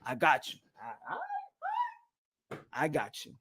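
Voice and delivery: a hint of backing down, lightly voiced; meek mock agreement. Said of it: "and be like".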